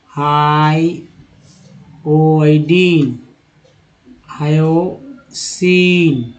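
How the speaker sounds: each word stretched out very slowly; slow demo pronunciation. Shown as a demonstration. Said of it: "फिर देखिए". (धीमा स्पष्ट उच्चारण)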